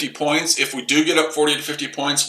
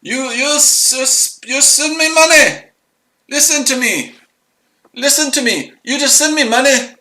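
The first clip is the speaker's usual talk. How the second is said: put-on much higher, pushy caricature Indian accent; mock Indian accent; 10 semitones higher than usual. Demanding money as a scammer, high mock Indian accent. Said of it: "I speak India's accent".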